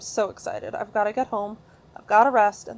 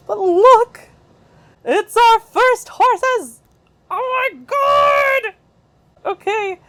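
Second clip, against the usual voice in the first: very high, loud squeal of excitement; overjoyed high-pitched shouting; ecstatic shriek; 13 semitones higher, 14 dB louder, much slower than usual. Real, not acted. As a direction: scream it out, stretching the words in sheer delight. Finally spots horses in game.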